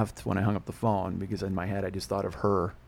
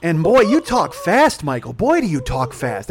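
loud, slow, stretched-out mom complaint; mock scolding mother; 6 semitones higher, 9 dB louder, slower than usual. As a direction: imitate an exasperated mother, dragging the words. Mother scolding son, acted.

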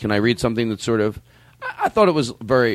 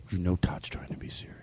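hushed whisper; 6 semitones lower, 13 dB quieter than usual. Real, not acted. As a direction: whisper it as an aside. Whispered aside.

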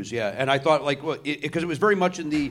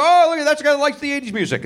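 high chirpy shark voice; 10 semitones higher, 4 dB louder than usual. Pretend. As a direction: voice a cheerful shark. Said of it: "They come at it, they go".

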